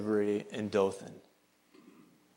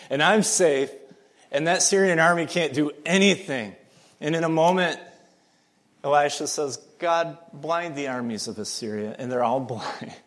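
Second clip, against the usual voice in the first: preaching at full force; 4 dB louder than usual. Acted. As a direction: build the sermon to a ringing peak. Preaching climax continues.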